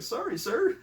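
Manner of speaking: very high mock kid voice; apologetic kid recruit. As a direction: squeak it like a kid answering the sergeant. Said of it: "he's like".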